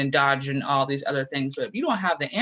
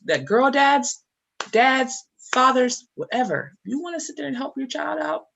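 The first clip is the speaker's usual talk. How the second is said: high, fired-up sales push; rally the dads with energy; excited pitch; 11 semitones higher, 3 dB louder than usual. Real, not acted.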